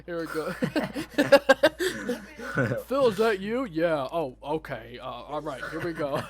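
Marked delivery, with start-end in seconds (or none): 0.5-6.2 laughing. Retold or imitated. Re-enacting scene through laughter.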